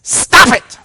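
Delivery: sudden high, loud yell; one explosive shouted rebuke; commanding yell. Acted. Jesus rebuking the storm, loud commanding yell. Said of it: "He yells at the storm".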